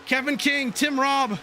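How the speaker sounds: brisk race call building toward the finish; racing commentator calling the last laps; live play-by-play. Acted. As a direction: call the pack as it jostles for position.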